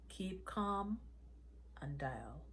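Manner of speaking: calm her and push her to dial, hushed; hushed urging. Acted. Joseph urges her to phone.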